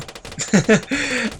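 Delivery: high-pitched laugh. Real, not acted. Player unsure about fast forwarding, high laugh. Laughs at himself after hesitating.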